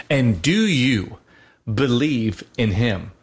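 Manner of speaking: slow, drawn-out rite question; solemn liturgical question. Retold or imitated. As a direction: intone it like a priest at a baptism. Quoting baptism service question.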